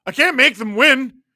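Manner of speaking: loud, high-pitched helpless plea; exasperated protest. Real, not acted. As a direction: throw it back at the fan, exasperated. Protests to a disappointed fan.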